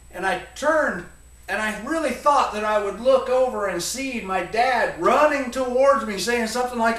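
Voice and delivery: ordinary delivery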